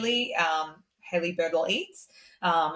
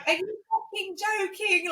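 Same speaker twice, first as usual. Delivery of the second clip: much higher mock disbelief; imitating a stunned friend, pitched up; incredulous; 14 semitones higher than usual. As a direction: friend ringing up, high and disbelieving. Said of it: "And she's like".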